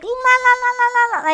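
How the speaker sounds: high, rapid "la la la" babble; sing-song drowning out what one won't hear; mock blocking it out. Acts out ignoring red flags.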